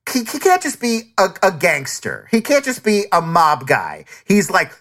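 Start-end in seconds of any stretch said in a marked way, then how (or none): none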